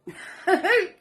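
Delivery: high breathy laugh after coughing; embarrassed laugh. Real, not acted.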